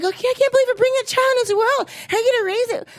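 high, fast nagging voices; judgmental relatives. Retold or imitated. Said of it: "They were so judgmental".

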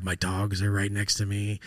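ordinary talk, nothing marked

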